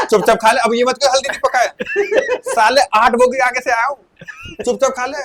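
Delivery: hammed-up vendor telling them to just eat; loud laughing scold; mock scolding.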